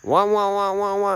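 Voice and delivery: engine rev noise. Retold or imitated. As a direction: mimic an engine hitting the limiter. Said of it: "red limited a car".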